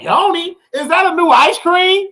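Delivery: high, baffled asking; puzzled high question. Retold or imitated. Hearing a strange new word, asking high and puzzled. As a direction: act clueless about the word. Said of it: "I'm like".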